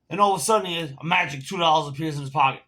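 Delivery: loud, worked-up telling; indignant. Real, not acted. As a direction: raise your voice in disbelief. Indignant climax of the story.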